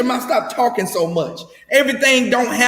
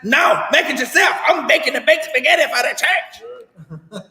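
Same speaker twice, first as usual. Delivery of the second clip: higher, hammed-up wife shouting back; wife snapping back; 5 semitones higher than usual. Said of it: "Then Norma Jean husband like".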